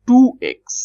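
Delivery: Two x called out high and loud; emphatic answer. Real, not acted. Teacher revealing the quiz answer to students, high and loud.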